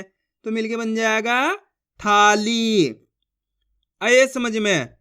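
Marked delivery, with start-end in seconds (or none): 2.0-2.9 थाली slow, both vowels held long; syllables drawn out. Shown as a demonstration. Teacher joins the syllables for learners, थाली drawn out. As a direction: stretch थाली into long थाआ-लीई. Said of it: "एक एक अक्षर का उच्चारण करके पढ़ रहा हूँ".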